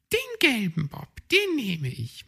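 high, polite hedgehog lady; old hedgehog lady voice. Acted. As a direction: ask in a high little-lady voice. Frau Igel chooses.

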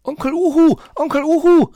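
put-on very high, loud child figure's shout; calling out. A narrator's reading. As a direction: call urgently for your uncle. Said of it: "rief Borstel". (laut und hoch rufen)

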